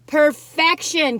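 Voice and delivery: slow, loud, savoring perfection; slow delighted Perfection; drawn-out rapture. Real, not acted. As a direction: draw out Perfection like a swoon. Repeats it.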